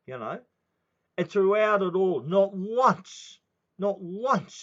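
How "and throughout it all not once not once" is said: louder, higher indignant shout; indignant outburst. Real, not acted. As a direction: hammer it out in outrage. Angry rant about child safety.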